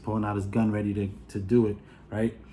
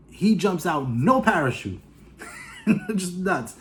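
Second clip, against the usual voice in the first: normal talking voice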